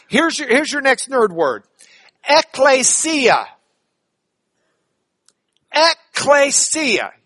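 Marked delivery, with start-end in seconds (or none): 2.3-3.5 ECCLESIA drawn out syllable by syllable, higher; teaching pronunciation. Shown as a demonstration. Teacher models a Greek word for the class, slow, raised pronunciation. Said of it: "Can you say it with me?". 5.7-7.1 ECCLESIA drawn out syllable by syllable, higher; teaching pronunciation. Shown as a demonstration. Teacher models a Greek word for the class, slow, raised pronunciation. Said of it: "Can you say it with me?".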